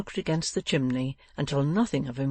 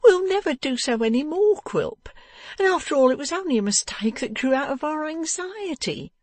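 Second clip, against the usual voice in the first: high, tearful wife's plea; distraught high-pitched excuse; sobbing; 13 semitones higher, 4 dB louder than usual. A narrator's reading. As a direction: plead in a high, weepy voice.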